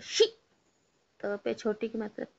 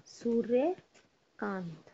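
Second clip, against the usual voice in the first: slowly drawn-out dictation; slow dictation of Suryakant; slow spelling; much slower than usual. Shown as a demonstration. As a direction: stretch each sound so kids can write it. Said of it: "बिंदी लगाएंगे".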